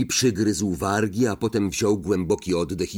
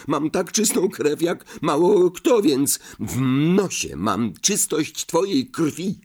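higher, indignant exclaim; calling out; 9 semitones higher than usual. A narrator's reading. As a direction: throw it back in his face. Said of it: "zawołał Harry". (zawołał z oburzeniem)

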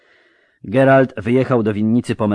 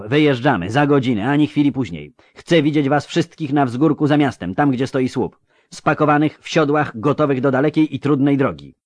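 raised, firm marching orders; brisk announcement; 8 semitones higher, 4 dB louder than usual. A narrator's reading. Geralt ordering the crew to ride out, brisk and commanding. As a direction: issue departure orders with no preamble. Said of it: "ogłosił bez zbędnych wstępów".

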